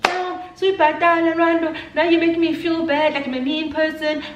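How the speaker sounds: high, whiny guilt-trip; put-on high, aggrieved voice; mimicked friend. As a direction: imitate her wounded complaining up high. Friend's complaint voiced in quarrel.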